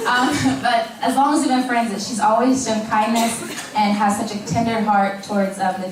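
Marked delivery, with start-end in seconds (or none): none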